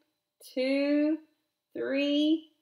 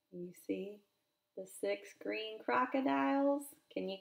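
much softer, hushed question; 8 dB quieter, faster than usual. Real, not acted. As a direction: lean in and whisper the question. Hushed aside to child.

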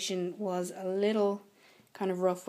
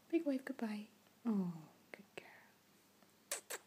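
whispering; 4 dB quieter than usual. Real, not acted. Soothing dogs to sleep.

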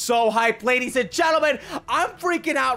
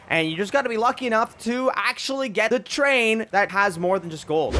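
normal talking voice

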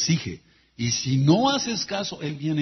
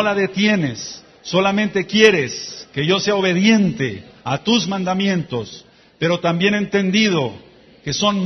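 slow, measured prayer swelling louder toward the end; led prayer; 4 semitones higher, 6 dB louder than usual. Acted. Preacher leads congregation in repeat-after-me prayer, building. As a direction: pray line by line for them to repeat, building up.

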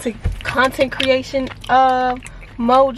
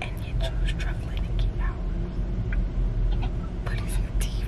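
slow, voiceless whispered dig; real hushed whisper to camera; 9 dB quieter, slower than usual. Real, not acted. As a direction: whisper it behind their back. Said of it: "You don't have to whisper".

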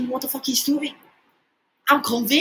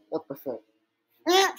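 ordinary talk, nothing marked

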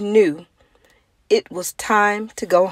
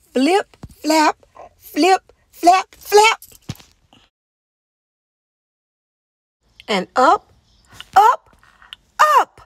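higher, louder, bouncing flaps; the joy of finally lifting off; excited takeoff; 11 semitones higher, 4 dB louder than usual. Acted. Penguin finally taking off.

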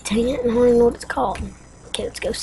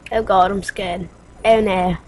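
ordinary talk, nothing marked

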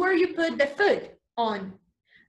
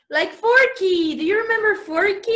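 playful excitement; 6 semitones higher, 5 dB louder than usual. Acted. Teacher excites kids with Forky.

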